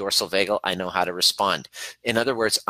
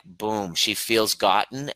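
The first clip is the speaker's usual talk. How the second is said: usual speaking voice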